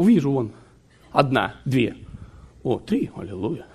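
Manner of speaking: usual speaking voice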